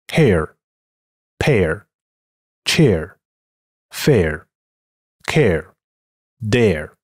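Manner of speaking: American hair, pear, chair ending in a curled r; American English. Shown as a demonstration. Teacher reads the same words in American English. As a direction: add the American r-colouring to each air. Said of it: "美音".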